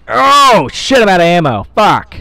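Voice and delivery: loud, high cry of alarm; panicked yell. Real, not acted. Player out of ammo mid-fight, panicked yelling.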